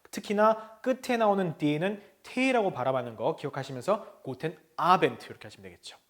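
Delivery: normal talking voice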